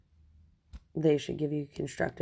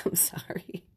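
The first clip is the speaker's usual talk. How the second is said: whispered sorry; 2 semitones higher, 5 dB quieter than usual. Real, not acted. Giggling whispered apology.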